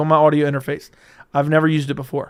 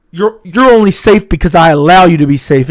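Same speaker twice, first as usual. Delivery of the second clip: booming, right up on the mic; loud close-mic menace; 11 dB louder than usual. Pretend. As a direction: blast it close to the mic for the listener. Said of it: "lean up close to the mic and talk loud".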